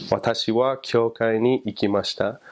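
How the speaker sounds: "Watashi wa kyoukai ni ikimashita." in smooth native-style Japanese; careful Japanese sentence demo, even syllables; spoken Japanese. Shown as a demonstration. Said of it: "you would say, like".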